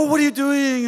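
high-pitched mock maternal dismay; drawn-up 'oh' of motherly alarm. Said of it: "Moms go".

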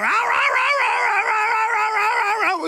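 very high yelping mock barks; shrieking imitated yelps; imitated dog screaming. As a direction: howl like a dog held back from its food. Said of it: "It's just screaming with its bark".

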